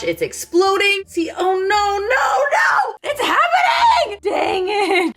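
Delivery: high, rising panicked cries of no; real alarm as the batter spills over; panicked shriek. Real, not acted. Waffle maker overflowing, panicked.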